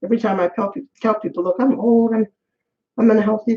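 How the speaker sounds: normal talking voice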